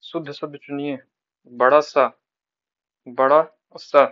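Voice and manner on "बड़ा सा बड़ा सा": बड़ा सा called out loud, twice; teacher stresses बड़ा सा loudly for the class; loud emphasis. Shown as a demonstration. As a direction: hammer the word बड़ा सा home. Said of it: "दोनों मिलते शब्द है".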